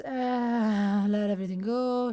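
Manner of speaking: drawn-out words on an out-breath; sighed release. Shown as a demonstration. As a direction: breathe the words out as you let go. Breathing out while guiding release.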